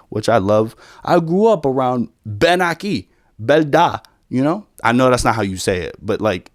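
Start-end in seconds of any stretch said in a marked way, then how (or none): 2.2-4.0 "Belda" as bel-DA, L in place of R; "Ben Aquí, Belda" with hard B and swapped letters; Puerto Rican pronunciation. Shown as a demonstration. Showing how Puerto Ricans talk, "Ben Aquí, Belda" with B for V, L for R. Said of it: "they pronounce words differently".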